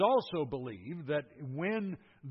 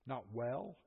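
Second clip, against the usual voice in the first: "well" said softer, singular, no final s; stress on well; 2 semitones lower, 6 dB quieter than usual. Shown as a demonstration. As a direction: set "well" against "wells" to show the singular. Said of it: "notice the plural wells".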